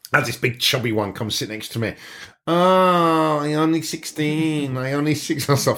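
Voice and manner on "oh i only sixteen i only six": slow, coy put-on voice of a bar girl; mock bar girl voice. Bar girl coyly pretends to be young to the narrator, slow put-on voice. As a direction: hams up a girl claiming she's young.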